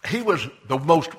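ordinary delivery

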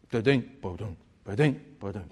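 playful spoken rimshot; mock rimshot. Rimshot after punchline.

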